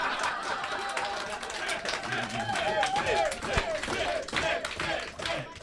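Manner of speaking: high, helpless fit of laughter; can't stop laughing; long laughter. Real, not acted. Host breaks down laughing.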